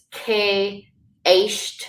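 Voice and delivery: "ke, esht" with the vowel of "bait" and "pay"; long ay sound. Shown as a demonstration. Teacher showing E with a fada, long ay. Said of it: "like the sound in bait and pay".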